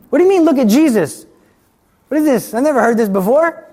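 high, loud incredulous questions; can't believe what he's hearing; shocked disbelief. Retold or imitated. Retold to the congregation, his shocked, high, loud disbelief at the retreat.

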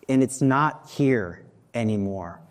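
ordinary talk, nothing marked

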